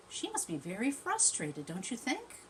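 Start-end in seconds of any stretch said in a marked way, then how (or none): none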